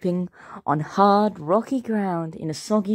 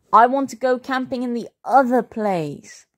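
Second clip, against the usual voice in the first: put-on high, complaining child; whining protest; 7 semitones higher, 3 dB louder than usual. A narrator's reading. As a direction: whine stubbornly to your parents. Sulky boy protests to parents.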